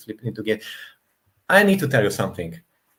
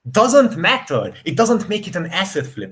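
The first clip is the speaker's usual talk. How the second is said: emphatic dismissal; 6 semitones higher, 5 dB louder than usual. Real, not acted. Arguing that BioShock is still good, emphatic. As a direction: shut the point down hard.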